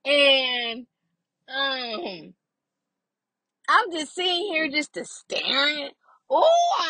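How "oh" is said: sharp high startled oh; surprised oh. Real, not acted. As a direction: jump at it in surprise. Sudden surprised exclamation.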